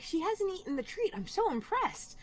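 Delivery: impressed. Retold or imitated. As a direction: gush over the cat's restraint. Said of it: "I was like".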